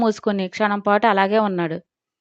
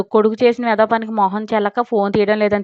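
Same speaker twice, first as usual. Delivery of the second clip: usual speaking voice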